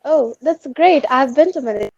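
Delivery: ordinary talk, nothing marked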